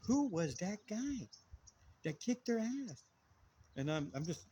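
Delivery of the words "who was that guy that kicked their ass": slow, higher imitation of a girl; girl's voice. Girl in the smoke shop line asking about the brother, imitated girl's voice. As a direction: draw it out as an awed girl would. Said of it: "a girl's voice going".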